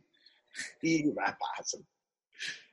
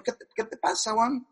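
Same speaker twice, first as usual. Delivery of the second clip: a hint of Chilean accent, softer, a touch higher; 5 semitones higher, 4 dB quieter than usual. Mónica Herrera asking Juan what's wrong, in a light Chilean accent. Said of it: "Ratanada se empieza a usar acento chileno".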